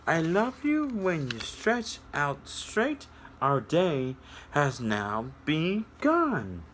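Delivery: sing-song read-aloud, slowing on the last line; storybook reading. A narrator's reading. Reading a picture book aloud to children, sing-song storytelling.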